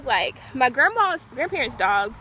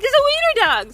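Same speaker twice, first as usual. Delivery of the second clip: thrilled announcement; 11 semitones higher, 6 dB louder than usual. Retold or imitated. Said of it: "I'd be like".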